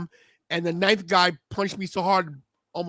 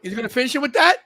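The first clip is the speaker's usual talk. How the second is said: louder and higher as the finish lands; excited shout; 8 semitones higher, 7 dB louder than usual. Real, not acted. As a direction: call the big finish like a hyped fan. Big finishing move live.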